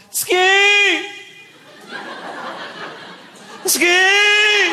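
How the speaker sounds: high, drawn-out warning holler; comic high-pitched shout; imitated yell. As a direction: yell it down the hillside like a skier. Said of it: "heard a guy yell".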